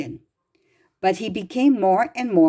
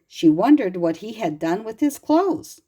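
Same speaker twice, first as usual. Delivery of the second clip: ordinary delivery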